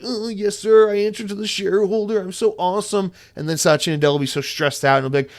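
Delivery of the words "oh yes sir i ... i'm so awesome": mock fawning yes-man, higher pitched; servile executive. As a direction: grovel to the shareholders, hammed up. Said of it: "then they'll be like".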